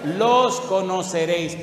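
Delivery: preacher pounding the key phrase; emphatic verse. Acted. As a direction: drive the verse home. Verse repeated louder for emphasis.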